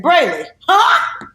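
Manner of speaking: high, slow, drawn-out call of her name. Retold or imitated. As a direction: lift your voice way up and stretch the name out.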